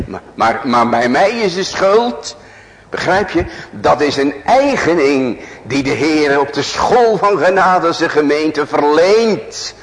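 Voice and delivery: high, loud preaching climax; fervent preaching. Acted. Preacher urging his congregation to become guilty before God, loud. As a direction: drive the point home with full force.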